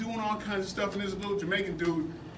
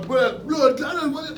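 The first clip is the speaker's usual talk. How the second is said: garbled, raised-voice mock Jamaican; mock Jamaican accent; 6 semitones higher, 6 dB louder, much slower than usual. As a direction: imitate a thick, hard-to-follow Jamaican accent. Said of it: "his accent was so strong".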